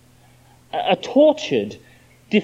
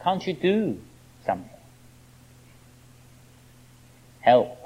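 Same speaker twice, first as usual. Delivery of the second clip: quiet desperate plea; 5 dB quieter than usual. Acted. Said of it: "what they're really meaning is".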